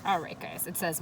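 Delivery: ordinary delivery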